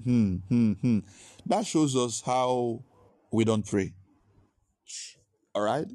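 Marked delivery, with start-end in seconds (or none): 0.0-1.0 mock moaning hums. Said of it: "Somebody said".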